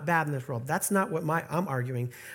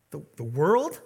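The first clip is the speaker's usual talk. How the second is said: mock surprise; 4 semitones lower than usual. Shown as a demonstration. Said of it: "if you hear that".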